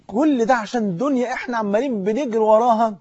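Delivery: ordinary talk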